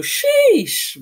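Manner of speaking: loud, high-pitched drawn-out sheesh; big reaction sheesh; excited exclamation. Real, not acted. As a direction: draw it out high and loud in hype. Reacting to spring break in story.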